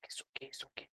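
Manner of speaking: soft, hushed reassurance; husband hushing his wife back to sleep; soothing whisper. Retold or imitated.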